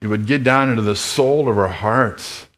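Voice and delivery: earnest, measured pastoral prayer. Real, not acted. Pastor praying for the congregation, earnest and measured. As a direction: speak to God on behalf of the church.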